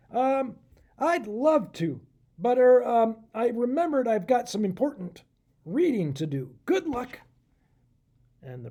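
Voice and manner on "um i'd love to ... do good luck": wriggle out of the ride; nervous excuse. Acted. Professor makes excuses, hides.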